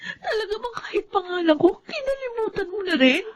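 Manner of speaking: high, soft voice breaking with tears; tearful, wounded reproach; crying accusation. Acted. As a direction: sob out the hurt at her mother. Daughter accuses mother, crying.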